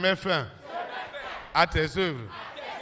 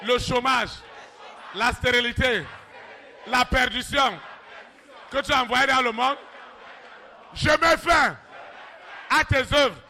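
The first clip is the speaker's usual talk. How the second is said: full-force preaching; 7 semitones higher than usual. Acted. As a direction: thunder against the devil.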